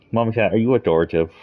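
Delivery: fast, high pet talk; talking to a cat. Real, not acted. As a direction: tease the cat playfully. Addressing the cat.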